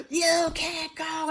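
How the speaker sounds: high, drawn-out yell; loud, slow mock shriek; imitated screaming. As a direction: scream it after someone escaping, stretched out. Acted supervisor screaming after them.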